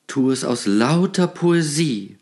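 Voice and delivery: a hint of grand stage declamation; lightly hammed-up plea for poetry; theatrical. A narrator's reading. Poet pleads with the shopkeeper, mildly theatrical. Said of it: "sagte der Dichter theatralisch".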